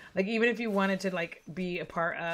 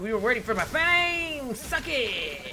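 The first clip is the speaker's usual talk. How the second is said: real triumphant shout, higher and louder; too excited; 6 semitones higher, 5 dB louder, slower than usual. Real, not acted. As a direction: crow over the win at full voice. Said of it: "I got too excited about that".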